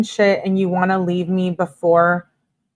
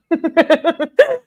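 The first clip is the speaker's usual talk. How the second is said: high-pitched giggling fit; real laugh; 11 semitones higher than usual. Real, not acted. Host joking to viewers about calling Angelo, high laugh. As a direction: laugh high at your own joke.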